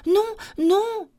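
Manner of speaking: very high little refusal; high storybook galette. A narrator's reading. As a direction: refuse in a high-pitched character voice.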